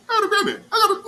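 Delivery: high, loud mock boast through laughter; laughing mock brag. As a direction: crack up while mocking the bragger. Mocking Patrick's bragging, laughing.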